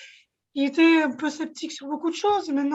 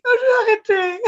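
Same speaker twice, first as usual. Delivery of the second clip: half-laughing, half-tearful panic, louder and higher; retold panic; 6 semitones higher, 7 dB louder than usual. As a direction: relive the moment you almost gave up. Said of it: "Gros moment de panique". (panique rejouée entre rire et larmes)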